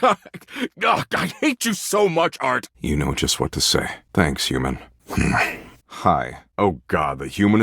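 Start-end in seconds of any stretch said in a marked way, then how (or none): 0.0-2.7 high-pitched whine of protest; exasperated protest. Acted. Sulker protesting to Art after tickling, high exasperated whine. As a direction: squeal the complaint, pitch way up.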